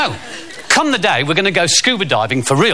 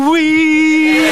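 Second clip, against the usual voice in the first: gleeful whee; 13 semitones higher, 4 dB louder than usual. Pretend. Husband surprising wife underwater.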